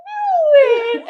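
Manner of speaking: high, loud, slow wail of dread; high wailing cry of dread. Retold or imitated. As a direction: stretch it out high and loud, breaking. Retold moment she broke down.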